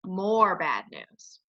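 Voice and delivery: slow, low title read; chapter title. Acted. Reader announces chapter title, slow low title voice.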